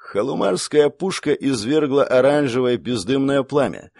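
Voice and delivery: ordinary delivery, nothing marked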